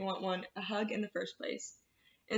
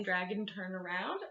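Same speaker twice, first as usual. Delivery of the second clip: ordinary delivery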